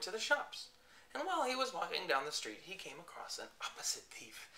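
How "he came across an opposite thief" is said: hushed storyteller whisper. A narrator's reading. Telling children a secret, whispered. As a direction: lean in and whisper it.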